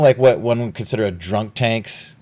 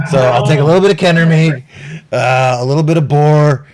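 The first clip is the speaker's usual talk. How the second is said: gruff captain; 5 semitones higher, 8 dB louder, faster than usual. Acted. Hostelon orders food.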